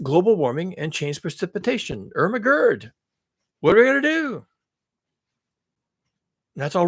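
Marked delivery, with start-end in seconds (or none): 2.1-4.5 hammed-up high-pitched dismay; sarcastic panic. Mocking the warming headline, sarcastic panic. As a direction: wail in mock helplessness. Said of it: "I'm being sarcastic here".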